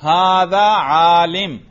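Arabic: هذا عالمٌ; slow Arabic reading. Shown as a demonstration. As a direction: read हादा आलिम aloud like a model sentence. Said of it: "क्या तरकीब थी".

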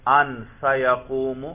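slow, deliberate ان سیقوم; slow example. Shown as a demonstration. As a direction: spell out the example carefully. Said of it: "کبھی درمیان میں سین لے آئیں گے". (آہستہ مثال)

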